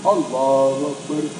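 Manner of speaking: a slow, low mumbled takbir; mock lazy prayer. Mocking someone praying half-heartedly, slow low takbir. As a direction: drag it out like a bored worshipper.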